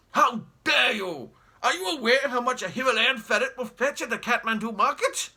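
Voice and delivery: put-on haughty old explorer, barking at the family; pompous great uncle. Pompous great uncle sneering at the spongers in his house, loud and scornful. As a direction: look down your nose and bellow. Jeremy indignant.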